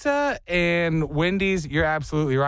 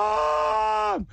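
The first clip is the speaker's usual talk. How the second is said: high wailing cry for mom; mock sobbing shout. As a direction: wail like a hurt little kid. Kid cries after falling.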